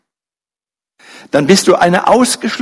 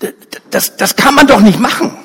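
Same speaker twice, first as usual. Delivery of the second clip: church leader objecting; 4 semitones higher than usual. A narrator's reading. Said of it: "der Gemeindeleiter denkt".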